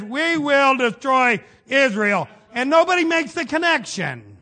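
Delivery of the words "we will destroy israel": louder, slow, drawn-out threat; hammed-up declaration of war; mock menacing threat. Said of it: "And then the next day he says".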